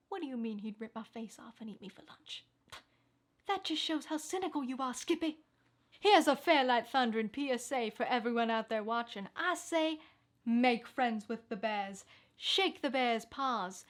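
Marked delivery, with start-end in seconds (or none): 0.1-2.4 quiet aside. Acted. Arguing with offscreen co-host. 10.4-13.8 slow, deliberate public announcement; slow PSA. Acted. Mock PSA to viewers.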